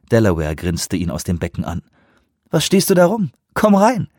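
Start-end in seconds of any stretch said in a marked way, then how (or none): 2.5-4.1 loud, high teasing invitation; teasing call. A narrator's reading. Delaware teases Leon from the pool, loud playful call. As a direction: call him in, louder, playful.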